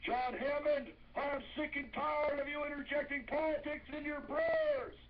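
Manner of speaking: high-pitched mock yell at the man; imitating a yell. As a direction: squeal it like an outraged churchgoer. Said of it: "She was yelling".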